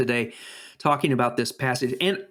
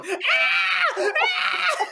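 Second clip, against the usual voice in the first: startled scream. Retold or imitated. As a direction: scream like a startled old couple. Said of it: "And they're going".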